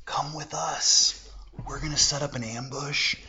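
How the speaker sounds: hushed gang invitation; sneaky robbers. Retold or imitated. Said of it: "the highway robbers are like".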